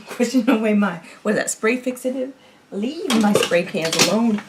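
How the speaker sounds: ordinary talk, nothing marked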